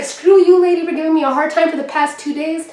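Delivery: telling off the guard lady, gloating; gloating yell. Retold or imitated. Retold triumph at the guard.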